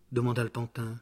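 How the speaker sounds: ordinary delivery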